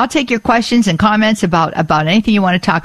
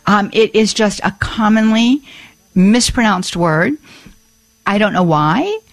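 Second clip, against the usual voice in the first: ordinary delivery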